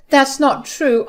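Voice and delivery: high, loud denial; pleading objection; desperate protest. A narrator's reading. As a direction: protest the accusation urgently. Mother-in-law protests the accusation.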